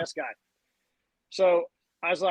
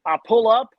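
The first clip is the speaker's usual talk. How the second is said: ordinary delivery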